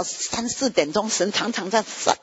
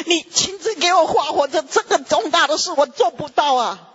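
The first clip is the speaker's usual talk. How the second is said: tearful plea; 6 semitones higher than usual. Retold or imitated. Pleading to God, crying.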